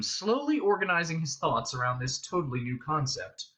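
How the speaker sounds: usual speaking voice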